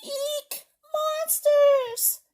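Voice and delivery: very high, acted cry of alarm; mock scared squeal. Trick-or-treater spots monsters behind the door, squealed alarm. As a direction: squeal in pretend terror for children. Character sees monsters in story.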